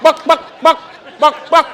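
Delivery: high, loud, building chicken clucking "Buck, buck". Retold or imitated. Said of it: "just going".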